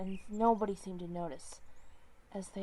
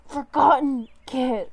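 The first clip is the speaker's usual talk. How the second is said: slow, full-throated, much louder scream; screaming a name; 4 semitones higher, 15 dB louder than usual. Acted. Despairing leader screaming for the stolen kit. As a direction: bellow the name with everything. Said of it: "screaming at the top of his lungs".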